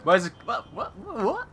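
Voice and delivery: rising what, what, what; bewildered, high questioning; confused. Real, not acted. Confused in game.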